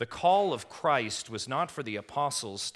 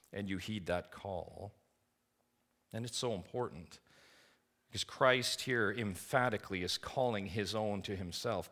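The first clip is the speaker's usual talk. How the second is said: usual speaking voice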